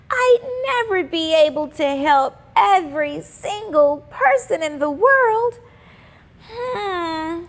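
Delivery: put-on high, bright little-girl voice; hopeful schoolgirl wishing aloud; young girl Simone. A narrator's reading. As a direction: read it as a dreamy young girl. Simone ponders.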